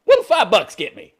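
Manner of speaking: high, loud naive question; hammed-up penniless buyer voice; hopeful broke customer. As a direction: ask it as a clueless, eager shopper. Acts out penniless Lamborghini customer.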